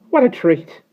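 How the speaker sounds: high, loud breathless burst of delight; starling gasping with excitement; panting with joy. Acted. Starling turning somersaults, panting out a loud high whoop of delight. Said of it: "he panted".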